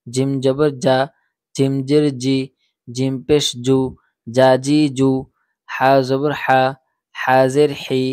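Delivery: loud, clipped drill chant, syllable by syllable; loud letter drill. Shown as a demonstration. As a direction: call out each syllable loudly for the class to repeat. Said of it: "একদম তাড়াতাড়ি উচ্চারণ". (জোরে জোরে অক্ষর উচ্চারণের অনুশীলন)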